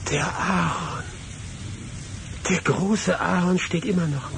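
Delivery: put-on sudden lift of joy at the old tree; light up, then marvel; excited recognition. Man returning to his childhood place, recognizing the tree excitedly.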